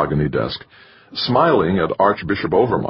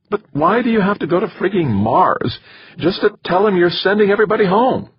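very high, distressed female voice, speeding up; upset wife; 4 dB louder than usual. A narrator's reading. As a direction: plead angrily in a much higher voice. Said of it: "She was really upset".

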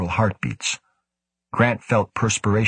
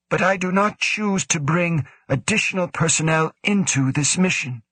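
higher, measured official voice; lightly voiced cool commanding official; stern director; 10 semitones higher than usual. A narrator's reading. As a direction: speak as the stern station director. Woe refuses.

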